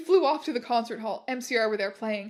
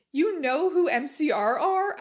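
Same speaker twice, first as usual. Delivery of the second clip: gasping question; 4 semitones higher, 5 dB louder than usual. Acted. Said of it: "I gasped".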